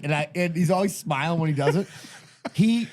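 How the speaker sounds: ordinary talk, nothing marked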